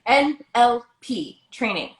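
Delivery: letters spaced out slowly. Shown as a demonstration. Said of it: "I'm saying that slow".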